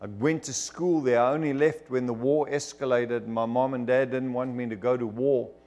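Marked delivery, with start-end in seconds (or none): none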